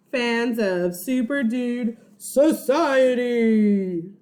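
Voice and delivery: slow, drawn-out proud club name; slow grand naming. Acted. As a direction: stretch each word out grandly.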